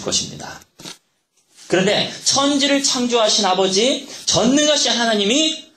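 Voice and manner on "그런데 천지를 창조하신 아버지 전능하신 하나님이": higher and louder, slow sermon climax; preaching at full force. Acted. 설교 절정에서 힘차게 외치는 목소리. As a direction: build the congregation toward God's call.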